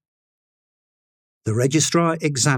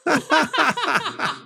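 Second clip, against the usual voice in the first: high, thin jeering laughter; mocking crowd laugh. A narrator's reading. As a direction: laugh at him like a crowd of students. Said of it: "The students laughed".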